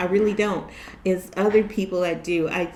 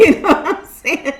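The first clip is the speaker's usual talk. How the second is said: laughing hard through the words; 9 semitones higher, 8 dB louder than usual. Real, not acted. Laughing at the modern insult she just said. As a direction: crack up at her own joke. Laughing at her own example.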